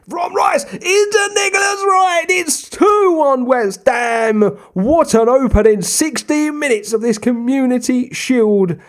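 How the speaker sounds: real goal excitement; excited goal shout. Real, not acted. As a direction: yell it like a commentator. Equaliser goal commentary.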